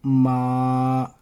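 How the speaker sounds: माँ held level and long. Shown as a demonstration. Teacher shows students the level tone on माँ. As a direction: hold माँ flat and steady. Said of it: "straight tone है".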